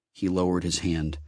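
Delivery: ordinary delivery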